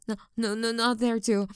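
usual speaking voice

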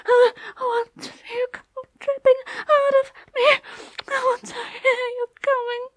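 high, loud, tearful begging; ecstatic pleading. Acted. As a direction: cry out in ecstasy. Loud pleading climax talk.

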